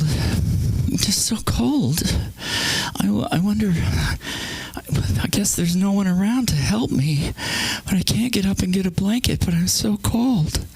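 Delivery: high, whiny, put-upon moaning; guilt-trip everyone within earshot; passive-aggressive mom. Retold or imitated. Mom lying there fishing for sympathy, whining passive-aggressively. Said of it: "really passive aggressive".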